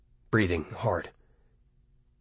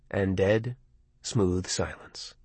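ordinary delivery

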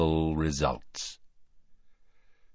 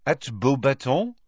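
complacent; 6 semitones higher than usual. Acted. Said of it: "said Fleur complacently".

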